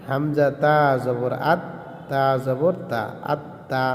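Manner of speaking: আত্তা with the তা held doubled, আত then তা; আত্তা: দ্বিত্ব ত স্পষ্ট করে, ধীরে; doubled letter demo. Shown as a demonstration. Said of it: "দ্বিতীয়বার নিজ হরকতের সঙ্গে".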